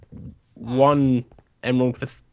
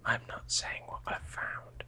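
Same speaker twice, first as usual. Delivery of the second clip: very soft, voiceless whisper; secretive whisper; 20 dB quieter than usual. Real, not acted. Player keeping a find secret, hushed whisper.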